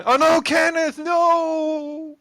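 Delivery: high, loud mock cry of despair; shouting. Player shouting after doomed Kenneth, high dramatic shout. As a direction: shout it high, stretching the last word. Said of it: "I'll just be like shouting".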